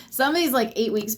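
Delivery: breathy laugh over the word. Real, not acted. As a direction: giggle it out. Laughs mid-reading.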